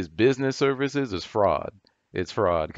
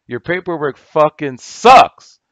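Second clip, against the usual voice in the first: much louder, higher and slower, a real yell; angry shout; 6 semitones higher, 12 dB louder than usual. Real, not acted. Repeating the insult to the rival guru, now shouting. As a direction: blow up and yell it at him.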